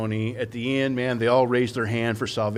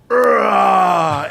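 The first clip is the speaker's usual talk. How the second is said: long, loud, high mock roar; playful roar; 7 semitones higher, 8 dB louder than usual. Entertaining the kids staring at him, playful roar. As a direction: let out a big drawn-out roar. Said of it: "so I just go".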